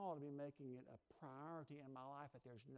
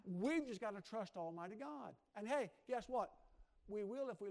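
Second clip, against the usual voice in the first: louder preacher's call, building to a cry; full-force preaching; 4 semitones higher, 6 dB louder than usual. Acted. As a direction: drive the congregation to break every chain.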